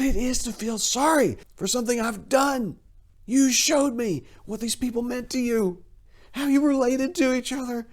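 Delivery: high-pitched, rising eager speech; excited. Acted. Cyril explaining what the family taught him, excited. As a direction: build it up with eager wonder. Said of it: "he finished excitedly".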